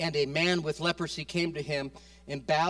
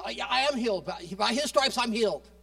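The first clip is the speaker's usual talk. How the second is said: parroting; 8 semitones higher, 7 dB louder than usual. Retold or imitated. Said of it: "like a parrot".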